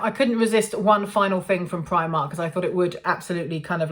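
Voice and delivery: ordinary talk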